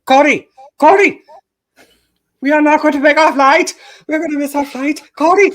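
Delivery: high frantic yelling, hammed up; panicked high-pitched friend. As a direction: shriek it like a frantic woman afraid to miss the flight. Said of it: "she's on the other side going".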